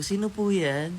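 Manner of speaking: high, loud polite call through the door; sing-song answering the door. Retold or imitated. Answering the doorbell as a child.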